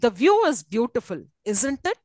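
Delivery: "beautiful" falls, "isn't it" rises; questioning rise on "isn't it"; rising question tag. Shown as a demonstration. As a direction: drop on "beautiful", lift "isn't it". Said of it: "It's a rising tone".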